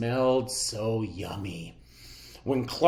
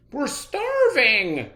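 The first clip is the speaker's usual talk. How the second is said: hungry friends; 14 semitones higher, 6 dB louder, slower than usual. A narrator's reading. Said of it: "said his friends".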